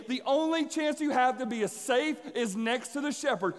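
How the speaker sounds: loud, driving sermon voice, pitched high; fired-up preacher pushing the climax home; preaching at full force. Acted. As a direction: build the story like a revival preacher.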